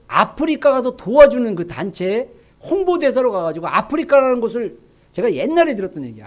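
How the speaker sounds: ordinary talk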